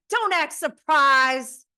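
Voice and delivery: mock outrage at the whole room; imitating angry Sheree. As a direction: throw it back at them, furious. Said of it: "Sheree's like".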